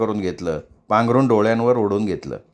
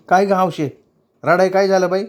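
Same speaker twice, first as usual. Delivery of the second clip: higher, gently worried question; young woman asking, higher pitched; concerned asking; 7 semitones higher than usual. Acted. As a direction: ask what's wrong, concerned. Bakula asks crying girl.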